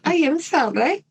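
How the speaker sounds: put-on a playful Italian accent on "I am sorry". Host redoes the killer's apology in an Italian accent. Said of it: "should I do that with an Italian accent?".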